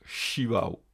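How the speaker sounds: "chivao" opening with a French sh. Shown as a demonstration. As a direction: start "chivao" on a hushing sh. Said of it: "il peut aussi avoir la prononciation ch". (« chivao » avec ch français)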